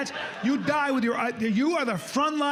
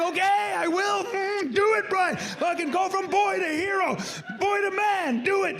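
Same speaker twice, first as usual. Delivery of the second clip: pitched-up hammed hero pep talk; mock hero psyching himself up, high pitched; high mock heroic self; 9 semitones higher than usual. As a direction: pump yourself up in a silly high voice. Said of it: "I'm like".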